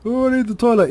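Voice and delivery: high, loud put-on plea; mock urgent complaint. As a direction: act out a sudden need, loud and high. Acts out a toilet stop exchange.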